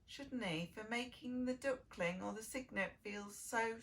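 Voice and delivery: usual speaking voice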